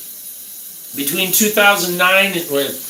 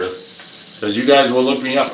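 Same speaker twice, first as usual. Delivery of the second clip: ordinary delivery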